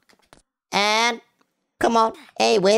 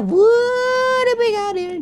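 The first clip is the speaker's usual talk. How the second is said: silly voice; slower than usual. Pretend. Silly put-on voice.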